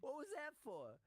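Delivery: very high mock mob voice; imitating Josh in the sketch. Retelling the sketch, very high mob voice. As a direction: ask it in a high gangster squeak. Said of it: "he goes".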